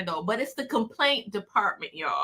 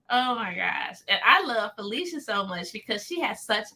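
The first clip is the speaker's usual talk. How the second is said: normal talking voice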